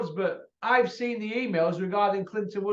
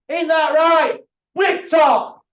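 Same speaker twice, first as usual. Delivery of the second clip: shouted taunt; 8 semitones higher, 10 dB louder, slower than usual. Real, not acted. Ranting at the rival promoter Bricktop, a loud shouted taunt. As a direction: yell his name at him, louder and higher.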